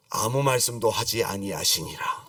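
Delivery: low, slow solemn quotation; solemn scripture. Acted. Preacher quoting God's silence after a promise, solemn and low. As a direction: drop low and draw out the verse.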